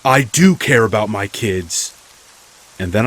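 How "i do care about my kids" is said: loud, indignant narrated yell. A narrator's reading. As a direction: shout it as the neighbor defending himself. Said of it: "I hear him yell again".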